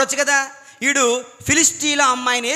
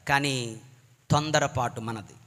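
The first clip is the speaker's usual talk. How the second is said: grave emphasis; 9 semitones lower, 8 dB quieter than usual. Real, not acted. Sermon emphasis on haste.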